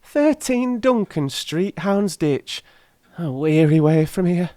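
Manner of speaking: put-on high, pleading old crone voice; a poor old charwoman begging humbly; old woman. Old woman begs the gentlemen for the ring, high and humble.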